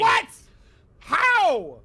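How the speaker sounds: loud high shout; yelling. Real, not acted. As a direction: yell the question. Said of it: "Don't be yelling".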